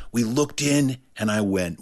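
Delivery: usual speaking voice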